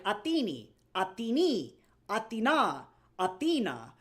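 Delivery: mocking run through Athene and Athena, stress shifting each time; inconsistent pronunciations. Streamer mimics game actor's Athene and Athena, inconsistent pronunciations. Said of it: "every time I play this game, he's like".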